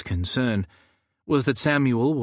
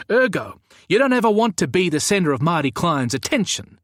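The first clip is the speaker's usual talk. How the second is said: higher, louder grave warning; stern warning; 7 semitones higher, 5 dB louder than usual. Acted. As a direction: Samuel warning Gaza off Marty, higher and louder. Samuel warns Gaza.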